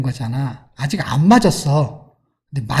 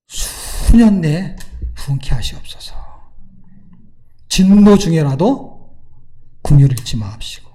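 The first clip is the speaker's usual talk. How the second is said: solemn prayer. Acted. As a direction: swell on the opening words, then drop away. Quoting the prophet's prayer in sermon.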